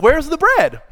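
high, loud mock challenge; mocking demand. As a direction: toss it out like a smug heckler.

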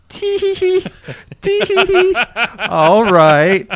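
high squeaky mischievous giggle; devilish giggle. Retold or imitated. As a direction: giggle like a naughty imp. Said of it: "you have this devilish grin".

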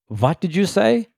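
put-on high, slow German-accented question; [name]. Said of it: "of a German accent".

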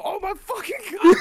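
loud, very high yell; retold yell. Storyteller shows friends how he yelled out, retold yell. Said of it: "like, I literally yell out".

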